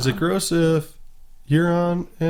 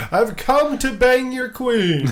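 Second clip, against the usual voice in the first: high, slow, grand boast; pompous villain; 10 semitones higher, slower than usual. Retold or imitated. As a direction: ham up a vain conqueror's entrance. Hammy Euron impression.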